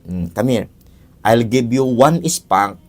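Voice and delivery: low, slow, measured scolding; stern parent lecture. Acted. Father explaining the spank to his child, low and slow. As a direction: lay down the rules slowly like a firm father.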